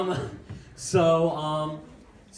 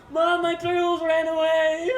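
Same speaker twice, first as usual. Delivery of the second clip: put-on high, loud, slowed child's wail; mock crying wail; 13 semitones higher, 6 dB louder, slower than usual. Kid wailing to mom about lost turtles, mock crying. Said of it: "I come in crying like".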